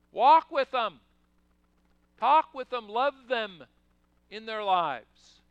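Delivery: preacher calling the flock to action; loud exhortation. Real, not acted. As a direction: drive each command home from the pulpit.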